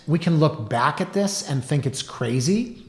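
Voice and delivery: normal talking voice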